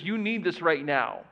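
usual speaking voice

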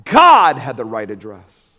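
slow, loud, low proclaiming; the preacher's loud climax to his congregation; preached punchline. Real, not acted. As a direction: hammer the punchline home slowly. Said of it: "listen to me tonight".

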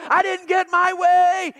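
high, loud, slow mock tantrum; mock the spoiled complaint; whiny brat. Mocking a generation that throws fits, loud whiny brat.

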